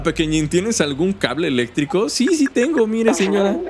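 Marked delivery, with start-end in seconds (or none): 2.1-3.6 much higher, small sweet voice; squeaky little cat voice; high cute cat voice. Acted. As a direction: answer like a tiny kitten. Voicing a cat answering.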